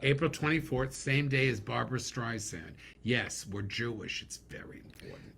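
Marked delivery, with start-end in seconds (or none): 4.2-5.4 soft whispered joke aside. Real, not acted. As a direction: drop to a secret whisper.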